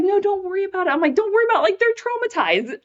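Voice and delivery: very high, incredulous retelling; squeaky mock dismay; amused protest. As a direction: protest in a high, laughing pitch. Retold protest, amused at own sobbing.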